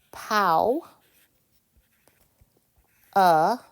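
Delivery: "pow", "uh" sounded out loudly, one at a time; syllable-by-syllable pronunciation. Shown as a demonstration. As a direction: teach "pow ... uh" as separate, emphatic syllables. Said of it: "let me give you the pronunciation".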